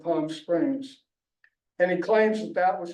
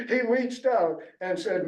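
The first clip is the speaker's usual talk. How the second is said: usual speaking voice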